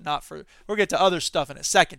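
usual speaking voice

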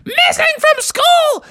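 very high, slow falling cry; alarmed exclamation. Acted. Dance class repeats the news, alarmed cry. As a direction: spread the alarm to everyone.

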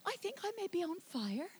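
hammed-up understatement, small and quiet; mock timid reaction. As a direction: ask meekly, as if not wanting to bother anyone. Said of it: "you don't go".

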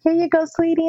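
high, sweet tender voice. Retold or imitated. Handing money to the crying girl, high tender voice. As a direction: soothe the crying child.

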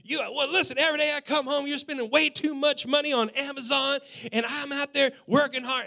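loud, high angry rant; tearing into her. Retold or imitated. Husband tears into wife about Amazon spending, loud and high. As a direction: shout him down, high and loud. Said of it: "I tore into her".

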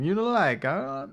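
mock Italian host asking; mock Italian accent. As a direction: imitate the pushy Italian waiter. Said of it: "every time".

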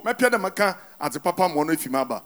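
ordinary talk

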